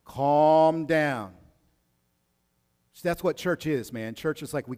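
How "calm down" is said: loud, firm calm down, raised pitch; Naomi calming Ruth. Retold or imitated. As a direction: call her down firmly, stretching calm. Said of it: "Naomi's like".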